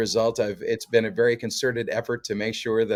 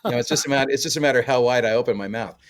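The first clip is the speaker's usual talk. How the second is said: ordinary talk, nothing marked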